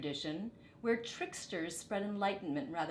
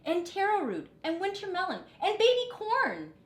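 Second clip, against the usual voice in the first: much higher and louder eager villagers; excited cry; 11 semitones higher, 7 dB louder than usual. A narrator's reading. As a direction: shout out more ingredients with rising excitement. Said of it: "cried other villagers".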